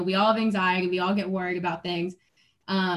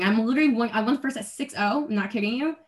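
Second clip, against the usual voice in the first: ordinary talk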